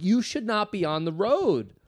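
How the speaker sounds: high, slow exasperated scolding; mock outrage. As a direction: scold a bad driver, drawn out. Acting out reaction to slow driver.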